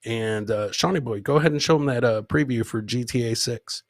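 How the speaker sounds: normal talking voice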